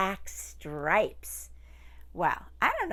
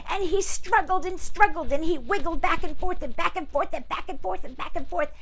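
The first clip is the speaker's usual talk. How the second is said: high, loud fast narration; acting out the struggle; excited struggle; 8 semitones higher, 7 dB louder, faster than usual. A narrator's reading.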